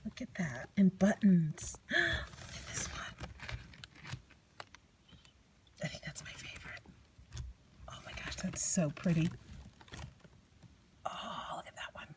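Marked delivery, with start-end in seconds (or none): 1.9-12.1 hushed, wondering whisper; very soft, breath-only admiration; awed whisper. Real, not acted. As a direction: whisper as if marveling to yourself.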